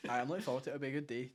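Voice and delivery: ordinary talk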